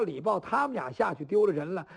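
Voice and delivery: ordinary delivery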